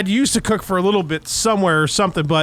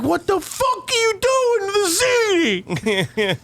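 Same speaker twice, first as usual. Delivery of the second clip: much higher, cartoonish angry dad voice; over-exaggerated dad; 12 semitones higher than usual. Son exaggerates dad yelling about the ziti, cartoonish rage. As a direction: overplay the furious dad. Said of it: "I like to over-exaggerate".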